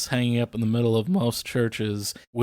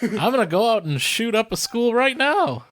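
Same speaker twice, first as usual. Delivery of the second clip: darkly joking impression; mock gun nut; 9 semitones higher than usual. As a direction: play the happy new rifle owner. Voicing Dan sarcastically.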